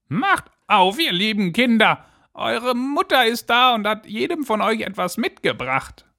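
wolf faking a dainty, very high voice; fine disguised voice. A narrator's reading. Wolf poses as mother at the door, high fine voice. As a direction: pitch it high like a false mother goat. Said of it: "machte damit seine Stimme fein".

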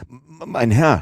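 high, stammering first words; breathless servant stuttering out an address; agitated stammer. A narrator's reading. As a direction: stumble on the opening sound in agitation. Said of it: "stotterte Passepartout".

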